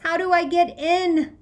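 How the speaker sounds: loud, high, slow desperate cry; desperate, choking yell; choked-out cry. A narrator's reading. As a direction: force the words out in panic. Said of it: "The words come choking out of my mouth".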